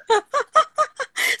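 a high-pitched burst of laughter; high squealing laugh. Real, not acted. Laughing at the imagined mom, she squeals with laughter. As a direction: squeal with laughter at your own joke.

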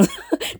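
very high short bashful giggle; a quick embarrassed squeak of a laugh. Real, not acted. Host giggles after quoting her fan comments, very high.

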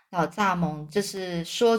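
ordinary delivery, nothing marked

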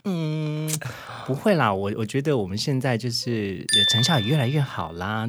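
ordinary talk, nothing marked

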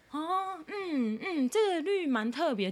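a touch of dutiful, high polite praise; meekly agreeing. Retold or imitated. To her friend about his watch, mild forced compliment. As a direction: fake politeness, just going along. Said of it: "我就只能够乖乖地说".

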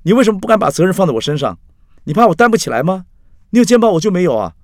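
high, loud accusing questions; heated protest. A narrator's reading. Drunk Axi demands that Carrie trust him, heated and loud.

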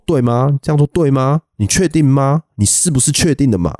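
high, needling sergeant voice; mock questioning sergeant. Sergeant grilling a trainee during the stress test, mock needling. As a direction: press the trainee like a doubting instructor. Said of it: "他会说".

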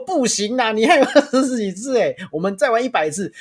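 put-on playfully refusing to let the tired kid stop; teasing excitement. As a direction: tease him with mock enthusiasm.

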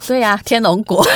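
ordinary talk, nothing marked

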